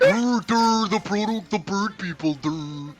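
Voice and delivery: mocking whiny villain. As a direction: mimic a self-important whiner. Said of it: "They're like".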